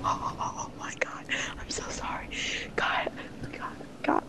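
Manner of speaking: hushed, breathless whisper. Real, not acted. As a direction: whisper the apology. Apologising after a kill.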